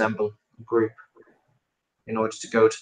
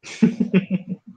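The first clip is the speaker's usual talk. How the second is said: short unvoiced laugh; quick airy chuckle; breathy laugh; 9 semitones higher than usual. Real, not acted. Laughter at table.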